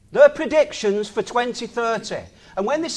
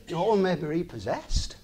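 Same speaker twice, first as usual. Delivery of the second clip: quieter, lower, no laugh now; sober; 5 semitones lower, 4 dB quieter than usual. Real, not acted. Repeating the advert warning to viewers, sober and quiet. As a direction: say it as a sad truth.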